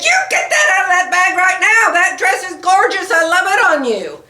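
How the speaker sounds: insistent husband voice, a touch hammed; husband's command. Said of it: "He said".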